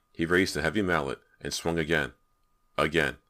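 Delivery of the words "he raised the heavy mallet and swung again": ordinary delivery, nothing marked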